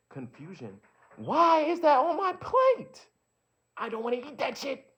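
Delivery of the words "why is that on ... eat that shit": high, very loud outburst; shouted indignant demand; exasperated shout. Acted. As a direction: yell it in outraged protest, stretching the words. Outburst at what's on the plate.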